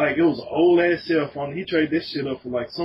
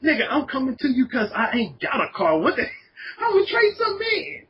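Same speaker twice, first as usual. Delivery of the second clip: indignant retort; 8 semitones higher than usual. Retold or imitated. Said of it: "I was like".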